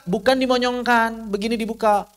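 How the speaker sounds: ordinary talk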